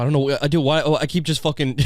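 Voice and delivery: normal talking voice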